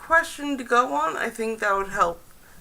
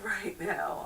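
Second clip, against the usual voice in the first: ordinary talk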